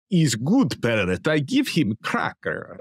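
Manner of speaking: put-on slow, broken-English Russian accent; a smug strongman in a slow Russian accent; Putin in a Russian accent. Host voicing cartoon Putin praising his parrot in a slow Russian accent. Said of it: "you do a great Russian accent".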